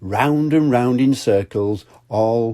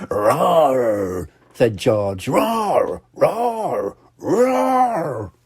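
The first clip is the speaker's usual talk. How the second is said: high playful little roar; toddler roar; 4 semitones higher, much slower than usual. Acted. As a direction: roar happily at the monster. George roaring to monster.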